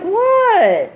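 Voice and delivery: high, falling, playing innocent; innocent what. Daughter feigning innocence to mom, high falling what. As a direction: act like you don't know, high. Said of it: "She's like".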